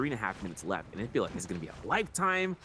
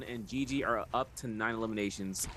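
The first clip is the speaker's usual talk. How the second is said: usual speaking voice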